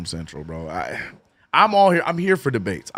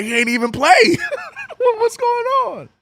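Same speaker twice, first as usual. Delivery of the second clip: high, squeaky laughing words; 4 dB louder than usual. Real, not acted. Streamer laughing at the benched player's knee wrap, high and squeaky. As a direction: crack up mid-sentence at the knee wrap.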